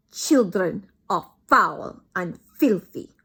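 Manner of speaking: put-on loud, slow accented witch boom; thundering. Grand High Witch to her audience, loud slow thunder. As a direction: thunder it with disgust. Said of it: "thundered the Grand High Witch".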